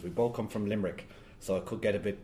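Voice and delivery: plain reading voice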